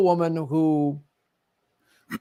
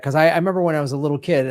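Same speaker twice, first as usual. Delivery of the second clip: ordinary talk, nothing marked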